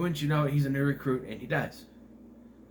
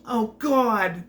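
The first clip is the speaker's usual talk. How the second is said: higher, louder, lightly voiced breathy exclamation; a hint of a Jennifer Coolidge gasp; an imitated American actress crying out to God; not like Jennifer Coolidge; 8 semitones higher, 6 dB louder than usual. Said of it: "she doesn't sound like Jennifer Coolidge".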